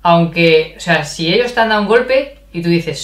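normal talking voice

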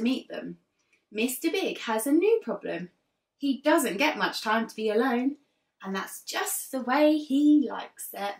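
put-on lively read-aloud for young children; teacher reading a picture book to her class; storybook narration. A narrator's reading. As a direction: tell it like a class story time.

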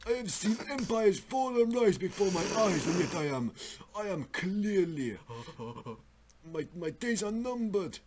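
put-on high, dying old man voice; ancient weary immortal. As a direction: rasp it out like a dying sage. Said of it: "Davey Fabber Four with lung cancer impression".

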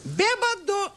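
very high falsetto taunt; piercingly high cartoon call; mysterious taunting voice. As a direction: squeal it like an unseen little heckler. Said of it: "he hears a voice that says".

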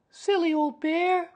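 put-on very high, slow little-boy voice; child amused, to himself. A narrator's reading. Boy so fond of the bear, amused aside. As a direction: say it fondly as a child. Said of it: "you laughed to yourself".

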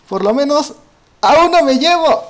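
rising, excited declaration of taking one down; determined vow. Real, not acted. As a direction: punch it out like a gamer psyching himself up. Vows revenge on teaming players.

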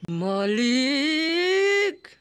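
mock wail. Drawn-out wail for master.

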